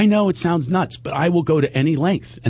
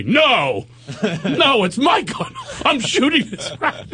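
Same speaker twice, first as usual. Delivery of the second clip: put-on defiant teenager; 11 semitones higher, slower than usual. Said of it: "Justin's like".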